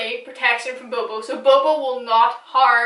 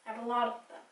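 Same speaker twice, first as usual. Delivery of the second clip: much softer, trailing aside; 12 dB quieter, much faster than usual. Real, not acted. Repeating to viewers as a quiet self-aware aside. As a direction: drop it to a muttered afterthought.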